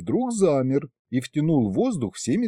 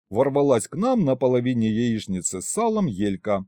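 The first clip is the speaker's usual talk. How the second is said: usual speaking voice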